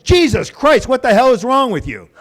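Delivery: loud, high outraged outburst; exasperated retold shout; yelling like maniac. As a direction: blow up at the cashier. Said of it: "I'm yelling at her like a maniac".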